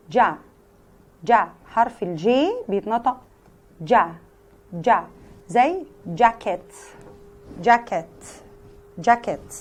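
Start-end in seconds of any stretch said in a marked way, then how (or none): 0.1-5.1 جا as an English j, repeated; teacher drilling the j sound; j sound demo. Shown as a demonstration.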